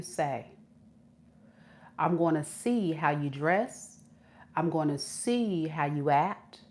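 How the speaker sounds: quiet, low, scheming inner voice; manipulator's inner voice. Retold or imitated. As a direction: lay out the plan softly, calculating. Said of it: "The mind of a manipulator is".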